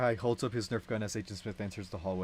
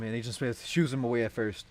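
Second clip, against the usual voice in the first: ordinary delivery, nothing marked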